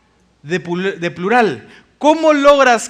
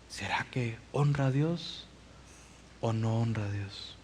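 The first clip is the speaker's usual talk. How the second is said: very soft, low, near whisper; whispered self-questioning; 9 semitones lower, 16 dB quieter than usual. Acted. Believer questioning every decision before God, whispered. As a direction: ask yourself under your breath, pausing midway. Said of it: "tú vas a decir".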